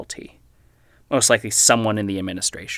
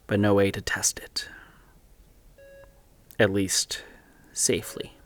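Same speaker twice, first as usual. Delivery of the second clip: whispered aside; 2 semitones lower, 4 dB quieter than usual. Acted. Private aside, musing.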